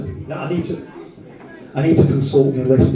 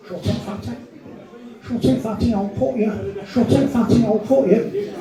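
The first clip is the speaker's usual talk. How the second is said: high-pitched mocking lad voice; mimicked scally; 8 semitones higher than usual. Scally answering back to the student, high imitated voice. Brick throwers' retort, acted out.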